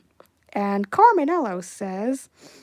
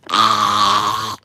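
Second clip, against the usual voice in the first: burst of laughter; 6 dB louder than usual. Real, not acted. Laughs at bouncing cheese.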